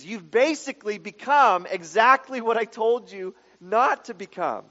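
loud, high, driving preacher voice; preaching at full force. Acted. Preacher hammering home the Canaanite warning, loud and high.

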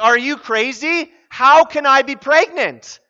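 loud, high, blunt disbelief; incredulous. Acted. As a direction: demand an answer, utterly flabbergasted. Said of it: "what she's saying is".

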